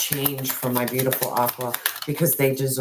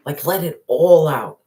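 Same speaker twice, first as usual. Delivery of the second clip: urgent call; 2 semitones higher, 7 dB louder than usual. Acted. Reader urging the viewer to release the pain, loud. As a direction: let it all out, pushed loud.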